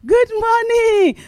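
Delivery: high, slow, sung-out Good morning; cheery greeting. Retold or imitated. Preacher showing how she greets her neighbour, high and drawn out. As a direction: greet brightly across the way.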